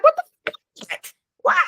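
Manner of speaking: startled high squeal of disbelief; a sudden, slowed, very high-pitched reaction; shocked what the fuck. Retold or imitated. Caught off guard by the phone in her face, shrieking in shock. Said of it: "I'm like".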